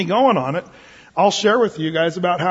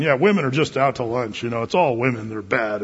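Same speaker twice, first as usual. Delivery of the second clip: bitter bar guy; 6 semitones lower than usual. Retold or imitated. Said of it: "talking to other guys saying".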